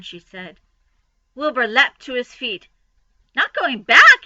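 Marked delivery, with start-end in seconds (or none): 3.3-4.2 high, loud startled cry; cried out. Acted. Wilbur crying out at Charlotte, high and loud. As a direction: pig crying out in shock. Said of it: "he cried".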